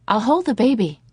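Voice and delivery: dazed mother to father, in a high sing-song. A narrator's reading. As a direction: sing it out, strangely light. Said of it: "in that same sing-song voice".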